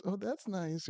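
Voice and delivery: light, high-pitched mom voice; clueless mom. Retold or imitated. Mom who has no idea answering dad, pleasantly clueless.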